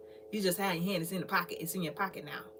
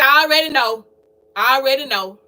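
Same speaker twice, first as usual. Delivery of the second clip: exasperated I already know; 11 dB louder than usual. Retold or imitated. Answering her children in an acted quote.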